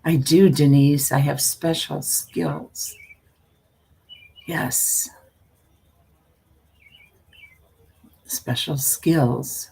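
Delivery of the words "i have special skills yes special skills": slow, hushed teasing whisper; playful mysterious hush; mock secretive whisper. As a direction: breathe it like a silly secret. Playful secretive boast to viewers.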